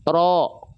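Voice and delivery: "Ro" held, heavy, vowel near O; thick ro. Shown as a demonstration. Teacher shows example of thick letter, heavy ro. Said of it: "bukan karena monyong".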